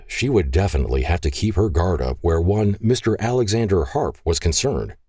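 ordinary delivery, nothing marked

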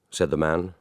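ordinary delivery, nothing marked

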